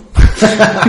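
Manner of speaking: bursting into loud laughter; real loud laugh. Real, not acted. Joke about suddenly speaking German.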